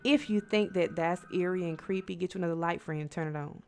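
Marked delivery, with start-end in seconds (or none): none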